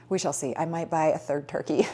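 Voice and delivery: normal talking voice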